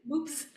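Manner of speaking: soft, high echo of BOOPS; imitated mispronunciation. Friend savouring the boyfriend's slip, soft mimicked mispronunciation. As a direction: quietly repeat "Boops" with a P for B. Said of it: "he always says "boops".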